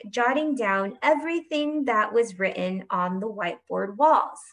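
plain reading voice

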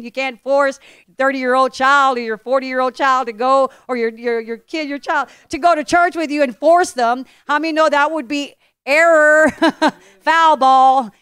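fired-up sermon voice; preaching. Acted. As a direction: drive the point home. Pastor preaching to congregation.

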